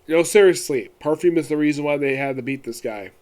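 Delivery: usual speaking voice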